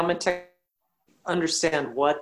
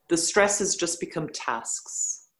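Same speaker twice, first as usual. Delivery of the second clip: usual speaking voice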